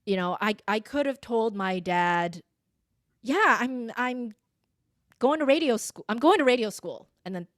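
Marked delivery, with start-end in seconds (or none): 3.2-7.1 louder, higher, decided statement; firm announcement. Shown as a demonstration. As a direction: announce it with no room for argument. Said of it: "that's the end of the conversation".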